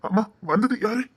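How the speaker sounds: ordinary delivery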